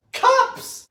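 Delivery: very high squeaked outcry; appalled falsetto exclamation; incredulous squeal. Real, not acted. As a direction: squeak it out in disbelief. Repeats it, more appalled.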